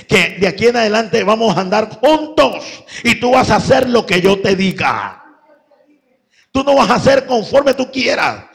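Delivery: loud, high preacher voicing God's orders; forceful pulpit exhortation; full-force preaching.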